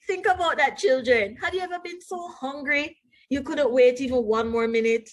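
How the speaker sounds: plain reading voice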